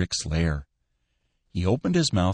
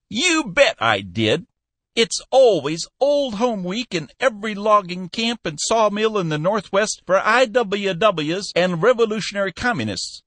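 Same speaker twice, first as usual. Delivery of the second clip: put-on high, louder old man pleading and scolding; scolding old Cappy; 14 semitones higher, 5 dB louder than usual. Old boss scolding his manager, high and loud. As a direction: rib him like a cranky old boss.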